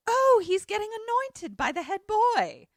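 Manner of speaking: high-pitched mock excited gasp; excited exclamation. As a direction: exclaim it like a thrilled onlooker. Acts out Clemsy watching the ritual.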